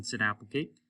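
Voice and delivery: ordinary delivery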